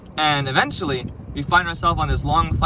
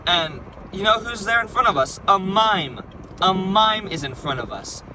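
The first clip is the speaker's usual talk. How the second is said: higher-pitched, astonished storytelling; dramatic reveal; 5 semitones higher than usual. Retold or imitated. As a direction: build up to a big reveal.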